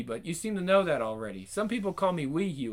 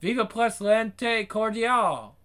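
slow, high mock French accent; 6 semitones higher, 4 dB louder, much slower than usual. Billy jokingly bids the Frenchman goodbye, mock French. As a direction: ham up a French farewell.

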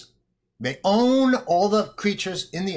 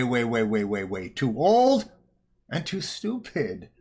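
normal talking voice

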